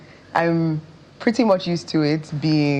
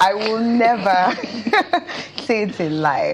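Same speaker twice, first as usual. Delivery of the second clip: laughing; 4 semitones higher than usual. Real, not acted. Laughs while answering.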